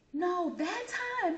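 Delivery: high, slow, teasing 'that time'; hint it knowingly through laughter; knowing friend. Retold or imitated.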